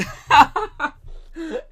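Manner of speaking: high, loud burst of laughing; loud laughter. Real, not acted. Comic after a mock job rejection, laughing loudly.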